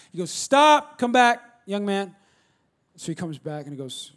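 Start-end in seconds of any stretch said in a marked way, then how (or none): 0.3-2.1 loud, high call to halt; sharp parental order, suddenly much louder; stern father's command. Retold or imitated. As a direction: imitate a dad stopping his son in his tracks. Pastor halts his teenage son, acted.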